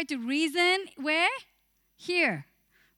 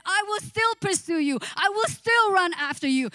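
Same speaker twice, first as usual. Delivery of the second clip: God's promise; 5 semitones higher, 3 dB louder than usual. Acted. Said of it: "but he said".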